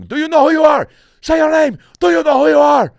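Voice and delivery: very high, very loud yelling at someone passed out; frantic shouting. Retold or imitated. Stranger yelling at a fainted man to wake up, high and loud. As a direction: yell it at a collapsed man, high and desperate.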